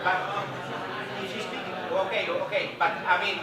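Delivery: speaking loudly over a room; loud over the group. Shown as a demonstration. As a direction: raise your voice over chatter. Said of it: "Can you try?".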